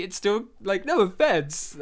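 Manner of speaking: ordinary talk, nothing marked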